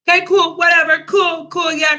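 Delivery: high, rattled-off brush-off; dismissive. Retold or imitated. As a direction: act like it's all fine. Brushing off her hosts' troubles.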